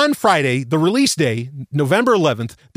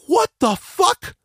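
slow, much higher outraged shout; 12 semitones higher, much slower than usual. Retold or imitated. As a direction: yell it out in disbelief, dragging it. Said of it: "And I was just like".

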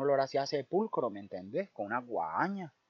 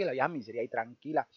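ordinary delivery, nothing marked

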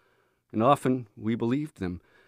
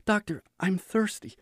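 timid plea; 10 semitones higher than usual. A narrator's reading. Frightened patient asks doctor.

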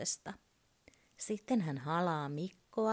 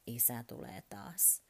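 ordinary delivery, nothing marked

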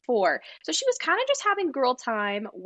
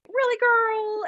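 high-pitched incredulous question; mock disbelief; 12 semitones higher, 4 dB louder, slower than usual. Host reacting to Kathy's upsetting act, high-pitched disbelief. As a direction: pitch it way up, unconvinced.

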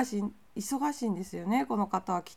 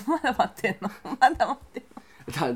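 laughing; 6 dB louder, faster than usual. Real, not acted. Teasing disbelief at waiting.

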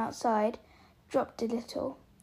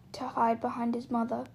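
ordinary delivery

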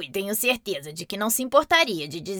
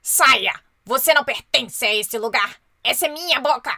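furious, much louder yelling to drive him out; angry shout; 7 dB louder than usual. Acted. A palavrinha brava grita com o intruso, zangada. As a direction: bellow at the intruder. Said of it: "ficou muito bravo e gritou".

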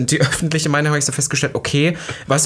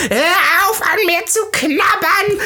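retold furious yell; slower than usual. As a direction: drag out a squealing outburst. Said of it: "er war vor allem so stinksauer".

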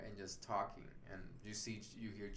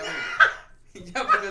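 real laugh breaking through the words, much higher and louder; 18 dB louder, slower than usual. Real, not acted. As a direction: talk through helpless laughter. Laughing at great-grandfather story.

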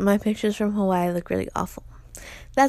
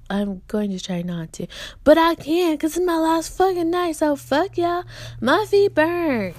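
higher, louder mock fury; pretend rant; 6 semitones higher, 3 dB louder than usual. Vlogger imagines her last night rant, loud. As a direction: go off on them, half playing. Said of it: "All of them just gonna be like".

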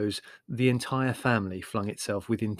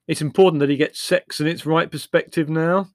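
higher, louder airy pronouncement; 6 semitones higher, 9 dB louder than usual. Acted. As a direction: lay it down breezily. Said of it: "Larry airily".

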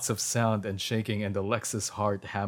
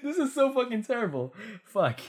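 very high, fast laughing squeal; cracking up; 12 semitones higher than usual. Real, not acted. Reader despairing at the terrible writing, cracking up. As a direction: squeak it out through helpless laughter.

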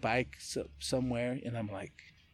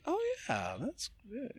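much higher, soft mock thought voice; imitating inner voice; 12 semitones higher, 7 dB quieter than usual. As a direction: voice the pleased inner thought lightly. Retold inner thought while running.

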